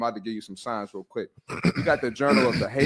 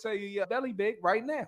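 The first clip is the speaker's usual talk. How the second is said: much higher mock TV doctor; hammed-up catchphrase impression, pitched way up; Doctor Now; 8 semitones higher, 4 dB quieter than usual. Explaining the doctor to his co-host, mock doctor impression. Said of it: "deliver like Doctor Now".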